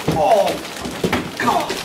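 pained groan while lifting; straining groan. Real, not acted. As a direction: groan under a heavy load. Lifting the heavy case.

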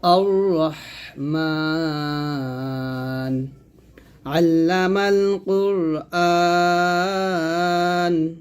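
slow, sustained chant with long held vowels; measured tajweed chanting; Quran recitation. Shown as a demonstration. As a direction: recite it slowly, drawing out each long vowel. Recites both verses together.

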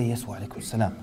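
soft, hushed reply to a greeting; whispered reply. Retold or imitated. Replying to a quiet greeting in the masjid, hushed. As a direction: answer back in the same hush. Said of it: "Immediately you start to whisper".

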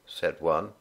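usual speaking voice

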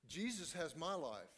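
normal talking voice

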